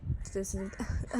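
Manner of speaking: ordinary delivery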